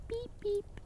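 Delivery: very high, soft squeak; squeaky little character voice; tiny thumb-sized boy. A narrator's reading. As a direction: peep like a tiny hidden creature. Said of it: "sa Tommeliten".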